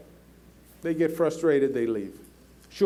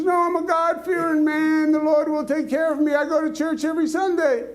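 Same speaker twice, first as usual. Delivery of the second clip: stubborn shouted refusal; 8 semitones higher than usual. Retold or imitated. Farmer on the roof shouts his refusal up to officials, defiant. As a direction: yell the refusal up, half laughing by the end.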